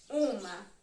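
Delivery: usual speaking voice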